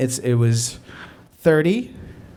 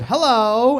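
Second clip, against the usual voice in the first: calling out; 7 semitones higher than usual. Retold or imitated. Calling to wake a sleeping woman.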